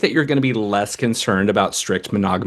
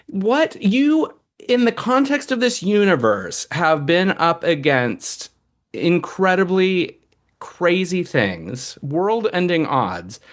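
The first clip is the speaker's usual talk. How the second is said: exasperated disbelief; 9 semitones higher, slower than usual. Retold or imitated. Mocking brooding heroes, exasperated.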